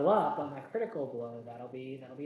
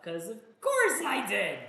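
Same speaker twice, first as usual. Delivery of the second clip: higher, drawn-out exasperated outburst; 5 semitones higher, 5 dB louder than usual. Real, not acted. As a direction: complain at your own rotten luck. Bad dice roll again.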